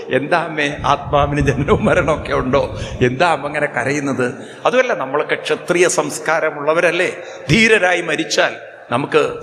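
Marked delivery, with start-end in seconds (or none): none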